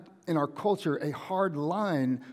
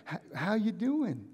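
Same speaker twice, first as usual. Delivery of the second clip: high, soft, hesitant cheery hello; happy greeting; 7 semitones higher, 4 dB quieter than usual. Acted. Visitor arriving with balloons in the desert, happy greeting. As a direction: greet them lightly with a stammered start, pitched up. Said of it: "and you're happy".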